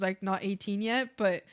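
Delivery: ordinary talk, nothing marked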